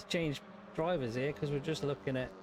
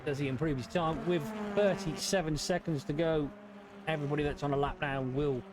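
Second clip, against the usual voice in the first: race commentary; 4 semitones higher than usual. Acted.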